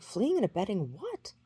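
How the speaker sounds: acted-out indignant query; incredulous retort. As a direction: throw the accusation back as a baffled question. Retold argument, baffled by charge.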